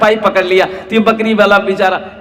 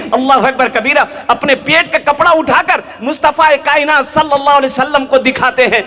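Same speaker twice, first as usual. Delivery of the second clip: fervent exclamation; 6 semitones higher than usual. A narrator's reading. Preacher exclaims in fervour.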